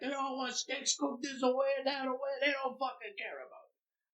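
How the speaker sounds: high, scornful complaint about the players; griping chef. Retold or imitated. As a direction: sneer like a fed-up private chef.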